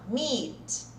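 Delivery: meat with a long "ee"; long I sound. Shown as a demonstration. Teacher models the second word, long ee. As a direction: stretch the í in "meat". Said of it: "Olha o som do I de novo".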